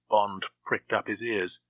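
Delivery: usual speaking voice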